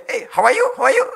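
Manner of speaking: loud, high English-speaking parrot; playful mimicked talking bird. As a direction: squawk a greeting like a pet parrot. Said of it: "Beo kita bisa bahasa Inggris".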